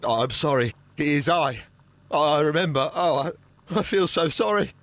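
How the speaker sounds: faint, shaken and stammering; feeling unwell. Real, not acted. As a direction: speak as if about to faint. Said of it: "Are you feeling unwell?".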